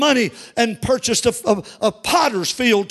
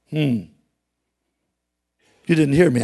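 usual speaking voice

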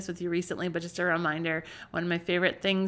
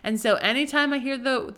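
normal talking voice

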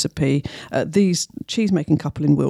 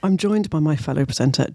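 normal talking voice

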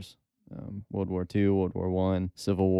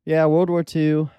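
"World War Two" lifted high and louder; emphatic; 8 semitones higher, 8 dB louder than usual. Real, not acted. Emphatic answer about his interest.

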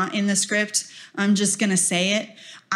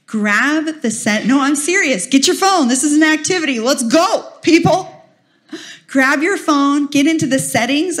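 higher and louder, laughing at the end; rallying call; 6 semitones higher, 4 dB louder than usual. Real, not acted. Speaker pushing the audience to grab phones, loud and playful. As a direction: rouse the room to pull out phones.

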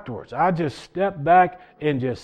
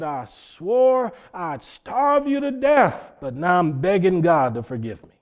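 retold shout; 3 semitones higher than usual. Preacher acting out the man at the altar, shouting. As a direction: cry it out like the confessing man, easing off after. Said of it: "he shouted and said".

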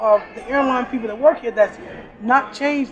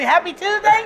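much higher, delighted repeat of the greeting; amazed mock greeting; 8 semitones higher, 4 dB louder than usual. Worker marvelling that coworkers greet her now, amazed. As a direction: echo their greeting in happy disbelief. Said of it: "you done got them to say".

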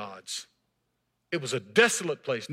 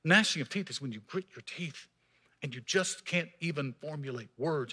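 normal talking voice

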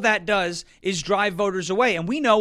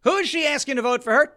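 usual speaking voice

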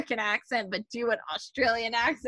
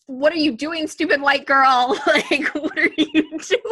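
retold yelling; 4 semitones higher, 5 dB louder than usual. Viewer yelling at Brett on screen, mock exasperated yell. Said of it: "we're all yelling at Brett".

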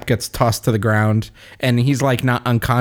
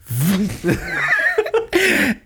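high delighted laugh. Real, not acted. Reliving the Mjolnir moment.

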